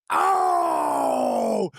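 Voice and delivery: deep, slow, drawn-out pained groan; mock groan. Opponent selling the hit, deep drawn-out mock groan. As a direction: stretch a low oh of pain.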